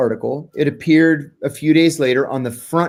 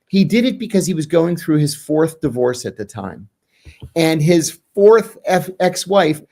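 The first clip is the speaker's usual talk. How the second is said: ordinary talk, nothing marked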